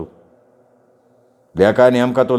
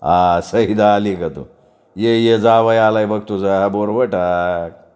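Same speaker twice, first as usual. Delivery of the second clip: slow, drawn-out jeer; shameless taunting; 3 semitones lower, slower than usual. Acted. Father greets chachi about her son-in-law, brazenly taunting. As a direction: drag the words out as a brazen father. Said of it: "निर्लज्जपणे हसला".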